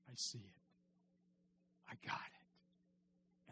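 soft, fading to a slow whisper; awed confession, barely voiced; hushed realization. Pagan friend finally believes.

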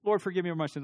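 quick, perfunctory prayer, tossed off; a hurried box-ticking confession; offhand rushed prayer. Acted. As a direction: rattle off the prayer in one breath. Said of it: "And we move on".

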